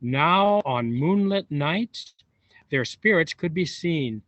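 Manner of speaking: ordinary delivery, nothing marked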